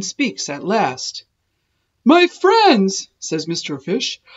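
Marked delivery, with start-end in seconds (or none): 2.0-3.1 bursting out loud and high; a sudden happy cry to his friends; joyful exclaim. Acted. Fish tells his friends with a joyful loud cry.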